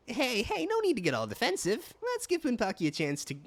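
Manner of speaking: high sidekick voice, chiding; high-pitched game companion. Acted. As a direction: pitch it up and protest as a little companion.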